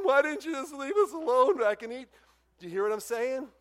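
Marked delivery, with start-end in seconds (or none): 0.0-2.1 high, loud, exasperated whine; mock complaining. Israelites at the first sign of trouble, loudly complaining. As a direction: protest indignantly, pitched well up.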